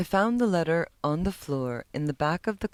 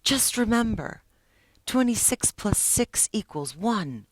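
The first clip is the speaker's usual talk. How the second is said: defiant; 7 semitones higher, 3 dB louder than usual. Acted. Sean defiant, pointing at tattoo.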